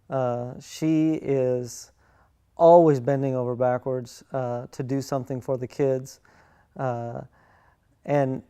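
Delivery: ordinary delivery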